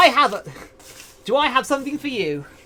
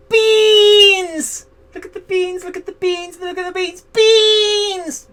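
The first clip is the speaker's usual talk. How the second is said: excited squeal; 7 semitones higher, 3 dB louder, slower than usual. Real, not acted. Excited about toe beans.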